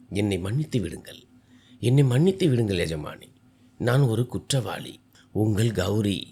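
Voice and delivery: usual speaking voice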